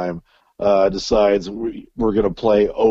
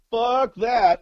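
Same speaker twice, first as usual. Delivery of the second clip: dismissive; 13 semitones higher than usual. Retold or imitated. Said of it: "I'm like".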